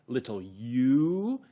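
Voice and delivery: "little you" with YOU stretched long and rising; stress on YOU. Shown as a demonstration. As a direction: lean hard on "you", drawing it out upward. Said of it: "So you can stress those two words".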